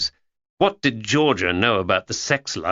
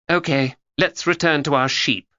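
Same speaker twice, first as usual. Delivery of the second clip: put-on young Georgia; 6 semitones higher than usual. A narrator's reading.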